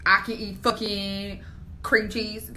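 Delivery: ordinary talk, nothing marked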